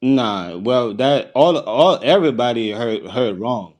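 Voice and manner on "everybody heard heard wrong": ordinary talk, nothing marked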